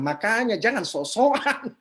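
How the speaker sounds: ordinary talk, nothing marked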